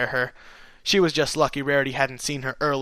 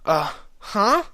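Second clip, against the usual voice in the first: high, confused, halting questions; flustered stuttering; 10 semitones higher, 4 dB louder than usual. A narrator's reading. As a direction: stammer in disbelief, pitch leaping up. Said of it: "stuttering in confusion, sounding extremely flustered".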